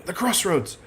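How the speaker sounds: Idra's mumbling. Retold or imitated. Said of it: "then she starts mumbling".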